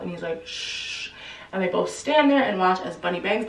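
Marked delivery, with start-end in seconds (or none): 0.4-1.1 soft unvoiced shush. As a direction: hush them like someone's at the door. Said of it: "puts his finger to his mouth".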